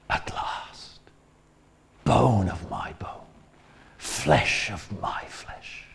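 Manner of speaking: hushed, slow, awed whisper of love; tender whisper. Retold or imitated. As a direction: breathe it like a man moved to tears. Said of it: "You can almost see the tears coming down".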